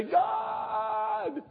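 high, whining plea to God; mock-whine it like a frustrated believer; hammed-up high complaint to God; whiny prayer. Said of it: "Sometimes we say".

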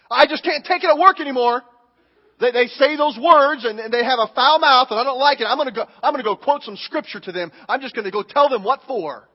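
fed-up coworker rant. Retold or imitated. Believer fed up with foul-mouthed coworkers, loud and worked up. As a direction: vent like you've had it at work. Frustrated believer voiced by pastor.

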